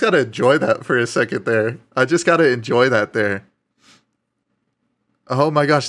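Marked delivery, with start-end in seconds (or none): none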